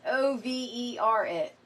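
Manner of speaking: O V E R spelled out slowly, letter by letter; emphatic spelling. Real, not acted. Wife on her husband's patience with her cough, O V E R spelled out emphatically. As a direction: punch each letter of O V E R slowly before "it".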